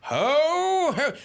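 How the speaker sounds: lightly voiced jolly Santa; a hint of jolly Santa. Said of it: "I'm gonna do the Santa voice".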